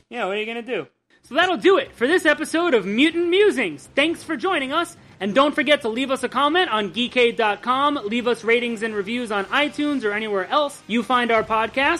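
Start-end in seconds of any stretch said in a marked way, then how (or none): 1.2-12.0 podcast outro. Acted. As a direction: wrap up the episode like a radio host.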